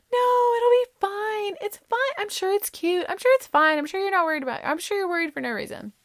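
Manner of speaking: very high mock-soothing friends; reassuring friends. Friends telling her it will be fine, high mock reassurance. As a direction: coo reassurance in a put-on high voice.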